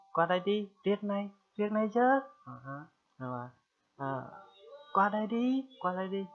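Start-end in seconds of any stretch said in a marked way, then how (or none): 0.1-2.2 loud, high call across the distance; calling someone over. Shown as a demonstration. Teacher demonstrates calling a friend over from afar, loud high call. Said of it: "cái cách mà người ta kêu". 4.9-6.3 loud, high call across the distance; calling someone over. Shown as a demonstration. Teacher demonstrates calling a friend over from afar, loud high call. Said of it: "cái cách mà người ta kêu".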